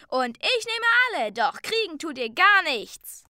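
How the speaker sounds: high, loud defiant shout; called out. A narrator's reading. Young gnome defying the bidders, high loud call. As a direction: challenge the whole crowd at high pitch. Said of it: "rief ein junger Wichtel".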